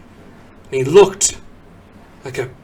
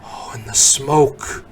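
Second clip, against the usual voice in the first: ordinary delivery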